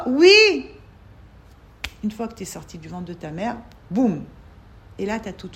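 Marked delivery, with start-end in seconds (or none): 0.1-0.6 loud high shouted OUI; called-out acted reply. Soul answering Hachem as it falls, loud high shout. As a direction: shout back as if from far off.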